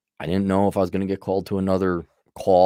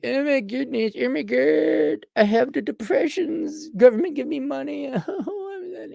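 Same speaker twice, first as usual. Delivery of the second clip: mock-whimpering high voice with a laugh; whiny loser. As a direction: ham up a self-pitying loser. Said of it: "Loser's like".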